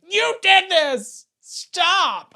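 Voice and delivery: loud, slow, high hammed-up shout; whiny furious commenter; mock angry yell. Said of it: "and be like".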